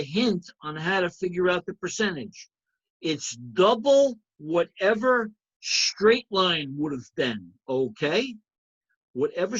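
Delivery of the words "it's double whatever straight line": slow, with "double" leaned on hard; teacher slowing right down to stress "double". Shown as a demonstration. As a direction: drag the phrase out and hit "double". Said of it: "I'm emphasizing the word double".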